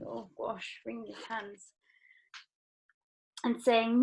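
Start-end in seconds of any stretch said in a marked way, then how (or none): 0.1-1.7 soft murmured aside; quiet aside. Real, not acted. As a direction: say it under your breath. Teacher aside.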